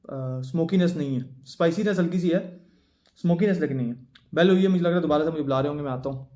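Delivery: ordinary talk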